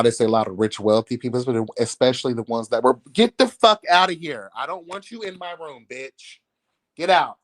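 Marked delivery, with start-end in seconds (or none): 3.1-7.4 sudden loud yell to get out; real shout. Real, not acted. Yelling at the pet trying to snuggle, loud. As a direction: shoo them out of the room loudly.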